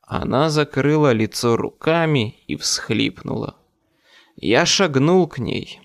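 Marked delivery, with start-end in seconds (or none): none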